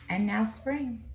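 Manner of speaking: ordinary delivery, nothing marked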